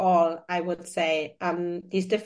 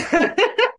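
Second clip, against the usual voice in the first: laugh; 11 semitones higher, 6 dB louder than usual. Real, not acted. Laughs at the joke.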